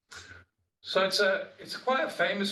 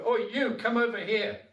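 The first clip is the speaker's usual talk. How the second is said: mock shout from an agitated debater; retold shout; 7 semitones higher than usual. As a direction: shout him over, impatient. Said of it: "he shouts over".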